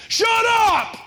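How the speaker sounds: very high, loud barked command; biker yell. Retold or imitated. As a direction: silence the whole gang at once. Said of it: "the guy goes".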